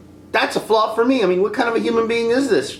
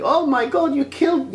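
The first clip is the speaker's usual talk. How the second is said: mock dismay; 9 semitones higher than usual. Mimicking Rey's weak reaction.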